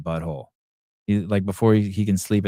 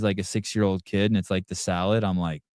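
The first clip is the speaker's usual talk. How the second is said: ordinary delivery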